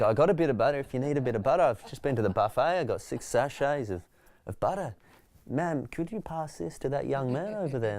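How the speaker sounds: eager helpful stranger. Retold or imitated. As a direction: play the keen bloke who has just raided the buffet. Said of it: "It's like".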